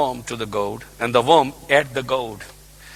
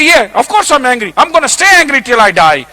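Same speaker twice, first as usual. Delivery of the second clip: defiant, higher and louder; stubborn prophet; 10 semitones higher, 10 dB louder than usual. Acted. Jonah refusing to let go of anger, defiant. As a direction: dig in like a sulky prophet.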